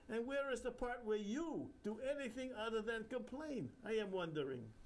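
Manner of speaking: put-on high, sing-song Indian accent; jovial Indian guru. Bapucharya teasing the narrator, Indian accent. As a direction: joke like a cheeky holy man.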